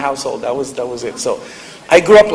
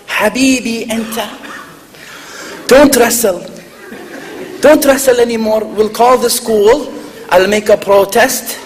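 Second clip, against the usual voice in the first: put-on slow, higher-pitched mother with an Arabic accent; Arabic mom; 4 semitones higher than usual. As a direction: dote and protest like an indignant Arabic mother. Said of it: "you know, the Arabic mom".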